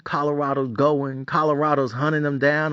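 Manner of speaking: usual speaking voice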